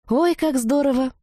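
put-on high-pitched girlish delight. A narrator's reading. Redhead rejoicing over evening plans, delighted. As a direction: cheer at the party plan.

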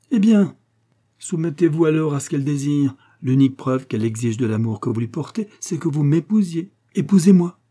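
high, emphatic ultimatum; imperious demand. A narrator's reading. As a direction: press hard, peaking loudly at the end. Maréchale demands that he marry her. (exigence impérieuse, voix haute)

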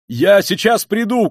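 high, loud, drawn-out call; shout. A narrator's reading. Razumikhin to the stricken mother, shouting. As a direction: shout it out slowly as you rush off. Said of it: "крикнул он".

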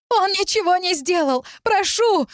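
very high-pitched begging cry; desperate pleading. A narrator's reading. Girl begging the crowd for her brother, pleading. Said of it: "взмолилась девушка".

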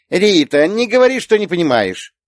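put-on high, loud childish telling-off; small girl lecturing a grown-up; scolding little girl. A narrator's reading. Little girl wags a finger at aunt, scolding. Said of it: "Лея погрозила пальчиком".

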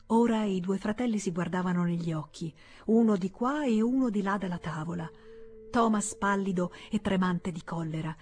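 ordinary delivery

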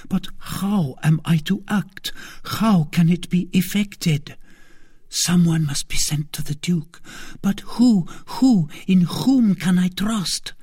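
high, anxious queen voice; a frightened queen searching for help; distressed queen. A narrator's reading.

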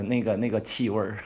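ordinary talk

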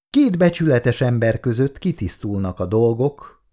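plain reading voice

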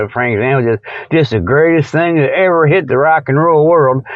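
ordinary talk, nothing marked

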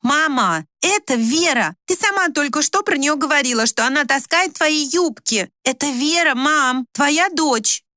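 high, insistent reminder; loudly. A narrator's reading. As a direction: raise the voice to get through to her. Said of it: "а потом добавила громко".

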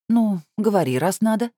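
high, resigned go-ahead; mildly put-upon consent; sighing. A narrator's reading. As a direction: give in with a light sigh. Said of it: "вздохнула я".

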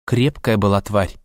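plain reading voice

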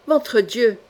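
ordinary delivery, nothing marked

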